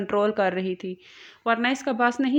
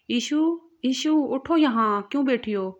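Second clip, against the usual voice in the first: worried; 4 semitones higher, 4 dB louder than usual. A narrator's reading. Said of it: "زاویار پریشان ہوا".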